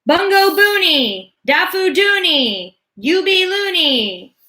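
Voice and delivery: very high, loud, slow scream; forceful scream. A narrator's reading. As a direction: force every word out. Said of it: "put so much force into his voice".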